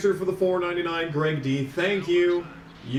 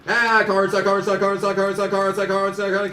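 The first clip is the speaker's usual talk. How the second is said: rapid auctioneer chant, higher; 4 semitones higher, 3 dB louder, faster than usual. Retold or imitated. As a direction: rattle it off like an auctioneer. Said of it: "he sounds like a fucking auctioneer".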